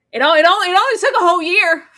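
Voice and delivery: high, loud, fast sarcastic complaint; exasperated sarcasm. Real, not acted. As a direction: roll your eyes at the delay.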